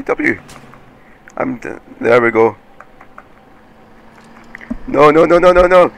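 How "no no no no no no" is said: rising panicked cries of no, loud and high. Real, not acted. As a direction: gamer yelling as his car gets crushed, panicked. Gamer panicking at crash.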